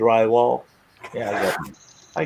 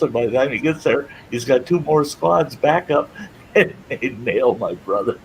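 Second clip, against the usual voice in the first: ordinary delivery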